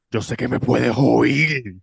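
slow, eerie menacing murmur; drawn-out spooky whisper-like threat; creepy stranger voice. Retold or imitated. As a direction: lean in like a creepy stalker. Retelling creepy man on bus.

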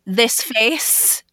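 normal talking voice

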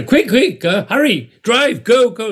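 high, urgent shouted commands; mock shout. Conductor orders his limousine driver, mock shouting. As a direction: fire off short, high orders in a hurry. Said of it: "he shouted at the driver".

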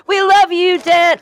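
high, loud cheering call; mock crowd cheer. Leading listeners to cheer for Dan, loud high cheer. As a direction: shout it like a whole crowd. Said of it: "Say it, remember?".

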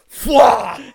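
loud burst of splattering noise; explosive raspberry noise. Retold or imitated. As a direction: blast it out like a splatter. Imitating sudden bowel release.

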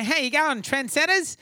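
high, tiny little comic voice; Rodney Roode's tiny voice. Retold or imitated. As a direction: squeak it out like a tiny heckling comic. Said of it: "this tiny little voice".

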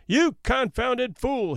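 high, slow roar. A narrator's reading. Chief officer roars at the man in the fog, high and slow. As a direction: bellow it out slow at the stranger. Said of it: "he roared".

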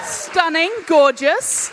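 loud, high, over-the-top gushing; lavish the panel with praise. Real, not acted. Host gushing loudly over her panel.

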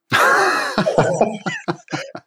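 real amused laugh, pitched high; high burst of laughter. Real, not acted. Laughing at own anecdote.

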